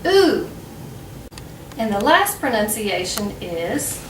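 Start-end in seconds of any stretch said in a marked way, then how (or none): 0.0-0.5 "ooh" isolated, higher, one long vowel; ooh sound. Shown as a demonstration. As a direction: model "ooh" as in through. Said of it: "can also be pronounced".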